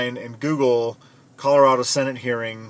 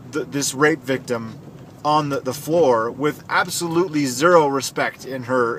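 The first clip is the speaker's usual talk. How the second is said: usual speaking voice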